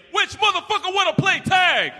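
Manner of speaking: high loud thug holler; thug yelling. Retold or imitated. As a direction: shout it across the playground. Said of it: "thugs in the playground saying".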